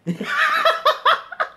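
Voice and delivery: very high-pitched cackle; laughter. Real, not acted. Streamer reacting to a dirty joke, high laugh. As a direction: burst into high, squealing laughs.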